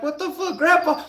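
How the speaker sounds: the words jump way up in shock. Retold or imitated. Hearing grandpa stole a car, high shocked outburst.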